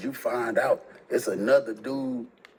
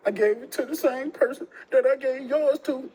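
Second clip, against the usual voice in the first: put-on girlfriend answering; 12 semitones higher than usual.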